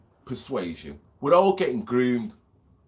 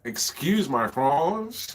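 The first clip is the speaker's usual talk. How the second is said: mock French accent; much slower than usual. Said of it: "Anglo-French vibe going".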